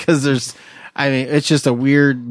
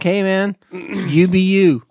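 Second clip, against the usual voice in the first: laid-back dude voice; 6 semitones higher, slower than usual. Retold or imitated. Mock advice to Florida Steve.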